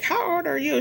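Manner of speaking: high, amazed retold question; retold amazed disbelief; surprised question. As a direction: ask it like you can't believe she's grown-up kids age. Surprised asking saleswoman's age.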